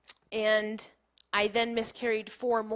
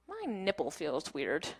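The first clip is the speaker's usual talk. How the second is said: puzzled realization; 6 semitones lower, 6 dB quieter, slower than usual. Retold or imitated. Retold puzzled realization to herself.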